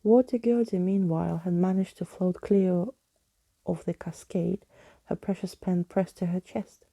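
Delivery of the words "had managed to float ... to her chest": ordinary delivery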